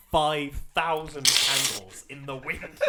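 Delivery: slow, loud mock-grand punchline; big slow payoff of a retold joke; dramatic reveal. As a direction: draw it out for dramatic effect. Acting out Andy's big punchline.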